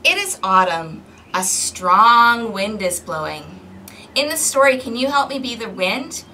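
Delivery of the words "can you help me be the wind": ordinary delivery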